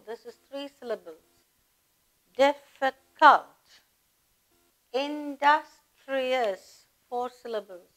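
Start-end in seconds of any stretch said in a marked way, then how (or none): 2.4-6.8 slow teacher sounding out the words; slow, drawn out. Shown as a demonstration. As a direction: stretch each word out slowly. Counting syllables of words aloud.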